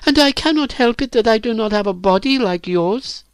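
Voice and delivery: put-on very high, wounded girl's voice; hurt protest. A narrator's reading. Virginia answers Phyllis's insult, hurt. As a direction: protest it high-pitched, stung.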